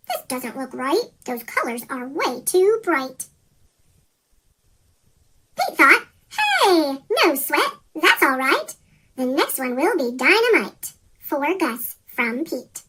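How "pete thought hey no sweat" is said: plain reading voice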